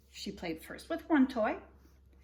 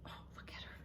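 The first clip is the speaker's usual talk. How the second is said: whispered aside; 15 dB quieter, faster than usual. Real, not acted. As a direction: hush and point it out. Aside to children while reading.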